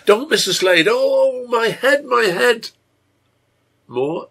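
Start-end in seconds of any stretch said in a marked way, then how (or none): 0.0-2.7 high, loud delirious cry; excited shout. A narrator's reading. Feverish girl crying out in her sleep, excited shout. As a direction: cry out in alarm and pain, voice pitched way up. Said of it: "she shouts in an excited voice".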